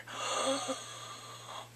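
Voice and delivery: high breathy gasp of delight. Real, not acted. Viewer swooning at the proposal, delighted gasp. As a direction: gasp as if the ring was for you.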